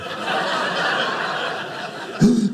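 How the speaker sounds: high startled intake of breath; sharp gasp. Acted. Husband catching his wife's cold stare, gasping. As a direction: gasp as if caught out.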